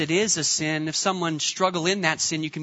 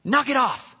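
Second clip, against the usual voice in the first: higher, sharp mock yell at the kids; a retold stranger's mock yell at the kids; angry man yelling; 6 semitones higher than usual. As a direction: imitate a man who lost it. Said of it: "this one man just kinda lost it".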